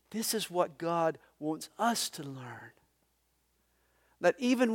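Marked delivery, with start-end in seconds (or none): 0.1-2.7 hushed whisper to the congregation. Real, not acted. Preacher whispering the lesson to his congregation. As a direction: lean in and whisper it.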